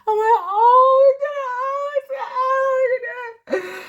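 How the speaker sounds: tearful joyful wail, drawn out; overwhelmed happy crying. Retold or imitated. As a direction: sob it out like a mother overcome with joy. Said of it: "I'm like".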